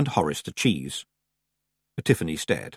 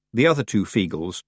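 ordinary delivery, nothing marked

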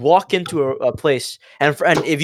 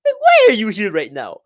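imitated foreign-accented official, pitched up; Indian-sounding accent; 4 semitones higher, 4 dB louder than usual. Embassy man questions two students, in an imitated Indian-sounding accent. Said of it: "there's an Indian guy telling them to leave".

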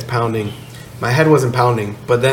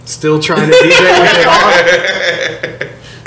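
slow, louder, through laughter; laughing; 5 semitones higher, 5 dB louder, much slower than usual. Real, not acted. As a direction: laugh it out slowly. Laughing at himself.

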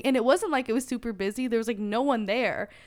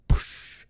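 whooshing "poosh", no voice; burst sound effect. Retold or imitated. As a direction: imitate a sudden spurting splash. Imitates blood bursting out.

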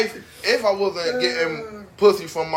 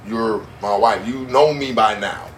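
lower, slow, calm talk to his wife; 8 semitones lower, slower than usual. Pretend. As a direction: soothe her as if she knows him well. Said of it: "addresses wife in demo".

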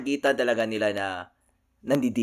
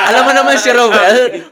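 laughing hard, louder and higher; 10 semitones higher, 13 dB louder than usual. Real, not acted. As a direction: tease him while cracking up. Teasing Roel while laughing. (tumatawang panunukso kay Roel)

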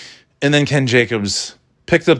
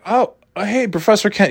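higher, eager greeting on the phone; surprised young student; 11 semitones higher than usual. Retold or imitated. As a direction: sound caught off guard and eager.

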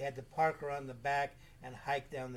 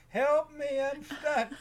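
high, loud holler as if trapped; mock cry for help; 11 semitones higher, 7 dB louder, much slower than usual. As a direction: yell it like a misheard distress call. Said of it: "they thought I was saying".

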